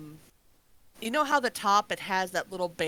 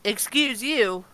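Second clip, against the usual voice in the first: mock offended; 3 dB louder, much slower than usual.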